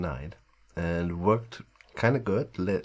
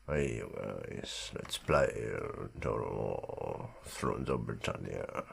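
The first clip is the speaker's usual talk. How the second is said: deep sleepy voice, just woken up; morning voice; 11 semitones lower, 7 dB quieter than usual. Shown as a demonstration. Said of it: "this is where the voice is coming from".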